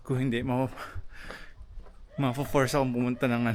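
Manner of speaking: ordinary talk, nothing marked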